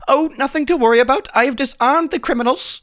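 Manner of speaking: high, fast, chirpy sheriff; breezy sheriff. Acted. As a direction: rattle it off high like an overconfident lawman. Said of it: "The sheriff comes by like".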